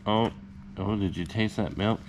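ordinary talk

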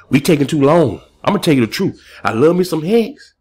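rising excited rant. Real, not acted. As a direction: pitch climbing, fired up. Excited rant about crypto.

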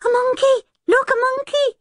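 put-on very high excited small boy; little boy's high, thrilled cry; delighted shout. A narrator's reading. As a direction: squeal it out in delight. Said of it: "shouting in delight".

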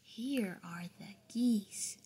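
slow, whispered reading. Acted. Mom whispering the story to her son. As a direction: hush and slow it down.

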